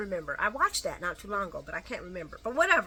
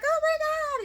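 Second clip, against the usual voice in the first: very high imitation of delighted shrieking; loud, high-pitched gushing; mock excited squeal; 5 dB louder than usual. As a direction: squeal it like a friend seeing the ring. Imitating friends seeing engagement ring.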